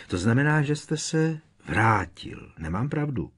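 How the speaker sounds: high, insistent questioning; urgent. A narrator's reading. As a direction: press urgently for confirmation. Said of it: "pokračoval naléhavě Harry".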